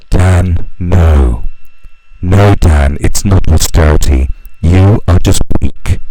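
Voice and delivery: loud exasperated rebuke; scolding shout. Acted. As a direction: shout him down, then slow on weak. Scolding Dan directly.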